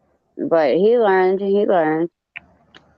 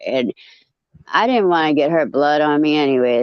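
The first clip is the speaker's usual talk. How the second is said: usual speaking voice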